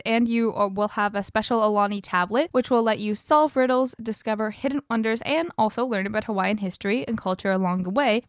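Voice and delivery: normal talking voice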